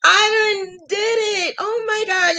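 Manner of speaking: high, near-crying shout; overwhelmed with happy tears; tearful joy. Real, not acted. Near tears, thanking viewers for donations. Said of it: "y'all gonna make me cry".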